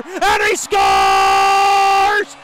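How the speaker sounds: drawn-out high, loud scoring shout; long goal yell. Real, not acted. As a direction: stretch the goal call as long as you can. Goal call.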